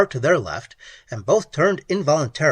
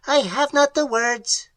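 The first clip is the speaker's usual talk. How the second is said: high, strange alien voice; alien creature; 11 semitones higher, 3 dB louder than usual. Acted. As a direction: speak like a clicking cave creature. Creature answers.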